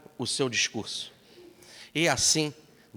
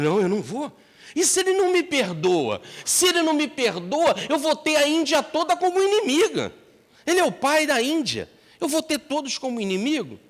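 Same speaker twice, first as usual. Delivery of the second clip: high, loud frightened pleading; panicky, voice pushed up; fearful refusal; 12 semitones higher, 4 dB louder than usual. Acted. The thief fears all of India will hate him, high and loud.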